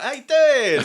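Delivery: very high, loud chummy greeting; over-friendly fan. Retold or imitated. Festival-goer greeting the guest, mock high and gushing. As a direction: gush like a stranger who knows you. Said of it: "they'll be like".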